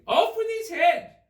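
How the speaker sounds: very high, loud furious shout; shouting Queen. A narrator's reading. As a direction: scream the sentence like a mad queen.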